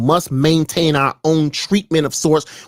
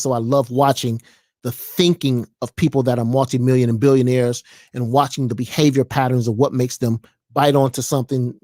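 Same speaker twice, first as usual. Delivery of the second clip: usual speaking voice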